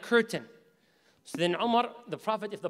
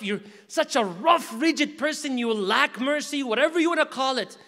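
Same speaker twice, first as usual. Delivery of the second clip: fiery preaching; 4 semitones higher, 5 dB louder than usual. Acted. Preacher urging the brothers and sisters back to the Quran, fiery preaching. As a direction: drive the point home to the crowd. Rising exhortation to the congregation.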